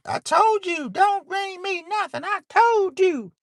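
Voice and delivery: very high, loud mom voice, quick and scolding; mom's voice. Retold or imitated. Son imitates his mom refusing gifts, high and scolding. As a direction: play his mother fussing at him. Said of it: "put on record".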